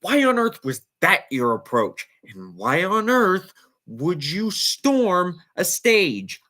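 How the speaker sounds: normal talking voice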